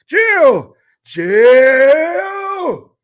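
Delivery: high, drawn-out mock holler; hammed-up high yell down the hall; calling out for help. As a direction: holler for Jill at the top of your voice. Acts out calling Jill for help.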